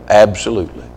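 usual speaking voice

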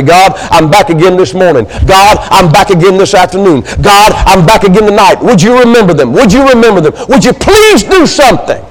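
acted prayer climbing steadily in pitch; insistent prayer. Believer returning to God morning, afternoon and night, building insistence. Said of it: "holding that person up to God and say".

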